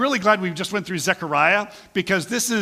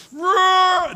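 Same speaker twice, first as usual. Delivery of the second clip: a much higher, loud hammed-up roar; mock roar; 7 dB louder than usual. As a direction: let out a playful monster roar. Mock table-flipping outburst.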